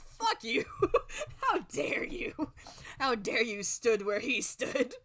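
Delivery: loud, high mock outrage through laughter; hammed-up indignation, slowing on the accusation; upset outrage. Gamer re-enacting their overblown reaction for friends, laughing outrage. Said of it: "legitimately upset".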